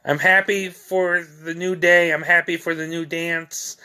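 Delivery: poem recitation. Acted. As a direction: read it out as verse, leaning on each repeated opening. Reads own poem aloud.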